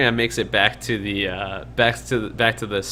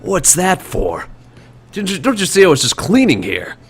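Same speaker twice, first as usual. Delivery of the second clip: startled barkeeper; 8 semitones higher, 5 dB louder than usual. Acted. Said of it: "he sort of leans back and he says".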